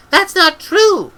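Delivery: put-on a girl flaring up in defiance; defiant outburst. A narrator's reading. Girl shouting back at the old witch, slow and defiant.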